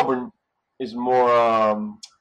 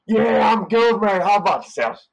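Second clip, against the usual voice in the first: loud, higher broad Australian mate talk; Aussie reply; 9 semitones higher, 5 dB louder than usual. Retold or imitated. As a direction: answer like a friendly Aussie bloke. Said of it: "You gotta say back to the guy".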